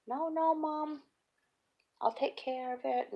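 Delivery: put-on high, pleading little boy; pleading son. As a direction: beg Mom like a kid. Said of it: "calls me up".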